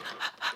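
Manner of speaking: quick acted panic gasp; mock little gasp of alarm; anxious gasp. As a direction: imitate short panicky breaths. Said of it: "sometimes little X gets".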